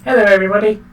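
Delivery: high, loud put-on character voice; loud, high-pitched game character greeting; Padwin. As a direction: greet everyone in a high, bright-eyed little voice. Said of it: "Padwin then comes rushing over".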